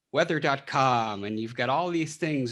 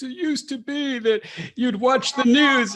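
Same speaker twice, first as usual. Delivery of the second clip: put-on much higher, louder old man voice; 13 semitones higher, 9 dB louder than usual. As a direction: play an old-timer reminiscing. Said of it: "I should use my old man voice".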